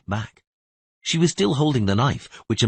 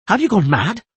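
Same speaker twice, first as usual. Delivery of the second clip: alarmed shout; 10 semitones higher, 4 dB louder than usual. Acted. Dandelion yells at Philippa.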